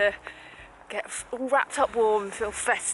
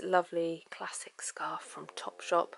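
whispering; 7 dB quieter, slower than usual. Real, not acted. Hiding gift talk from mum.

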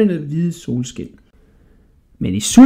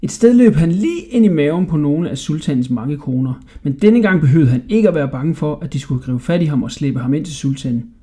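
ordinary delivery

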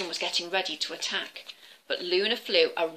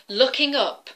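plain reading voice

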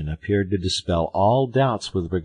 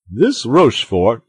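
loud, higher exclamation; crying out; 7 semitones higher, 11 dB louder than usual. A narrator's reading. Porthos rails about the cardinal's man Rochefort, crying out. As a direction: cry the name out indignantly. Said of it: "cried Porthos".

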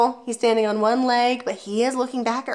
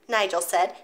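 usual speaking voice

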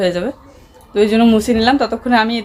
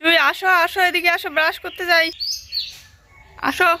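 calling out; 8 semitones higher than usual. Real, not acted. Mother calls Jui to come brush teeth, calling out. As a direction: call the little one over, pitched up.

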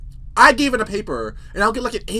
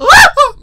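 burst of laughter; 13 dB louder than usual. Real, not acted. Laughing at own story.

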